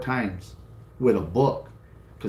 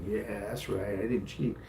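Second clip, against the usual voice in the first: softer, smug little aside; smug mutter; 9 dB quieter than usual. Retold or imitated. Boy to teacher beside his dad, a soft smug aside. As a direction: quietly gloat beside your dad.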